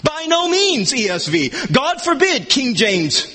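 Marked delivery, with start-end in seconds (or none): 0.0-0.9 "By no means" held high; high, emphatic "By no means"; emphatic negation. Shown as a demonstration. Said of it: "it's so emphatic". 1.7-2.5 "God forbid" lifted high in pitch; high, emphatic "God forbid"; emphatic negation. Shown as a demonstration. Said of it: "it's so emphatic".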